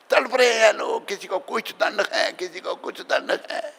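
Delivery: high, lightly wailing storyteller; mild dramatic lament; acted anguish. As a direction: voice the torment of hell, pitched high. Voicing the torment in hell.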